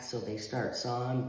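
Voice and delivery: ordinary talk, nothing marked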